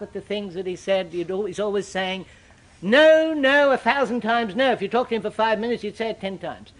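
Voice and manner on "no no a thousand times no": loud, high opening no's, easing off after; imitated emphatic refusal. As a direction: mimic a preacher's booming catchphrase. Imitating Moody's favourite emphatic refusal.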